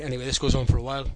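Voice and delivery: usual speaking voice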